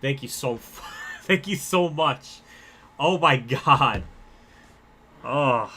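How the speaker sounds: slow, loud, laughing gratitude; overwhelmed thanks. Real, not acted. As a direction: thank them, close to tears. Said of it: "you're making me cry".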